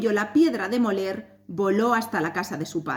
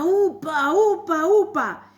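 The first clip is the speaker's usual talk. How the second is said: high, louder effortful calls; heave-ho calls; 8 semitones higher, 4 dB louder, slower than usual. A narrator's reading. Twenty millers heaving up the stone, calling out loud. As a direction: call out as you lift together.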